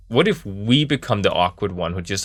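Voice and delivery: usual speaking voice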